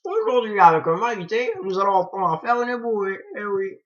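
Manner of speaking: loud, high-pitched accent impression of a French TV cook; hammed-up TV cook accent, loud and high; Maïté. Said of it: "mon accent de Maïté".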